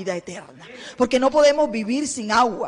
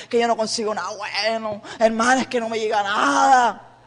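grumbling complaint; 2 semitones lower than usual. Retold or imitated. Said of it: "hablan y refunfuñan".

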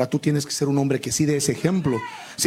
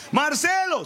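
calling mother; 9 semitones higher, slower than usual. Retold or imitated. Mother calls son.

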